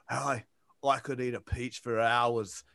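low, soft Australian-accented impression; understated Aussie movie-star impression; Hugh Jackman. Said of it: "Hugh Jackman's like".

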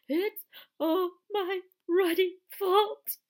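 loud, high, drawn-out tearful wail; sobbing wail. A narrator's reading. Hagrid blaming himself at Harry's bedside, sobbing. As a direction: wail it slowly through sobs. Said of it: "he sobbed".

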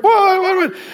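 high, rising alarmed repeated what; the wife caught off guard, asking again and again; startled what. Retold or imitated. Startled wife questioning husband's sudden prayer, high.

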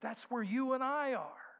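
ordinary talk

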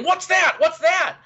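high, loud imitation of clamouring pupils; excited yelling. Last year's class interrupting the teacher mid-talk, yelling. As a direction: yell it out like kids interrupting class. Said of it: "started yelling and going".

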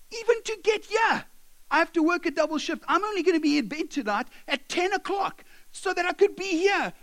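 high-pitched outraged rant; acted exasperated outburst; indignant. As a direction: protest like a fed-up worker, voice climbing. Slave protests at Paul's letter.

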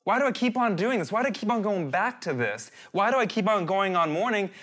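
exasperated string of whys aimed at yourself; questioning himself. Acted. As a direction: press each why, acting out self-doubt. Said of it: "now he's questioning himself".

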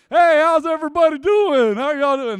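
boisterous hello to a whole classroom; cheerful greeting. Retold or imitated. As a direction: barge in greeting everyone with glee. Cheerful entrance into quiet classroom.

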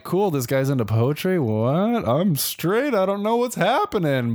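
high, mock-oblivious voice, rising toward the end; clueless guy missing the hint, voiced high; clueless straight guy. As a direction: play dumb about the hint. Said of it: "I'm like".

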